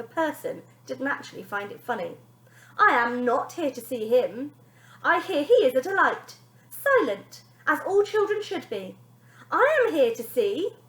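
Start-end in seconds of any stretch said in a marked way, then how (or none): 2.8-10.8 stern nanny. Acted. Nanny speaks slowly.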